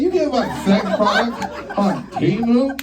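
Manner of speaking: high laughing disbelief; high incredulous question. Retold or imitated.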